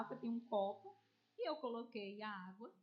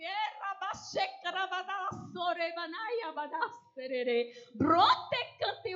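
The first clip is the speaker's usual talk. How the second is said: loud, higher, drawn-out tongues burst; speaking in tongues; 8 semitones higher, 10 dB louder, slower than usual. Retold or imitated. Preacher bursts into tongues before the armed man. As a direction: pour out the tongues in a fervent rush.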